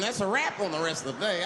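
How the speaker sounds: ordinary talk, nothing marked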